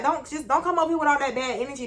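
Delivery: mildly pleading, a little high; not rude. Retold or imitated. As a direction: insist gently without sounding rude. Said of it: "I ain't said it in the rude way".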